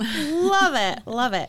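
bright burst of delight; excited. Real, not acted. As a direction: cheer it out. Excited reaction.

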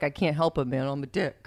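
talking body part. Acted. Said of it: "and the dick's like".

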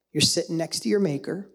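ordinary talk, nothing marked

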